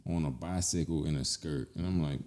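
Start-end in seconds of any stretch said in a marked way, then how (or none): 0.1-1.1 a little southern twang on "bicycle"; low-pitched "bicycle" with a light southern twang. Real, not acted. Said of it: "He said a bicycle ... a little southern twang".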